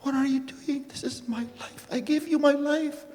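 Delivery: high, strained cries of betrayal; retold anguished screaming. To his best friend who tore the book, screaming in anguish. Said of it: "I started screaming".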